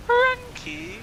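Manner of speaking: shrill squeal of run key; high shout. Real, not acted. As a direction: yell it up high.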